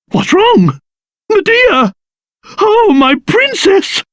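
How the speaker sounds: high-pitched, anxious cry to a loved one; alarmed concern. Acted. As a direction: call out in theatrical alarm for the princess. Alarm over the princess.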